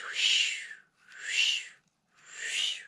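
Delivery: breathy whispered whoosh sound; whooshing wind. Acted. Reader making the wind blow the hat away.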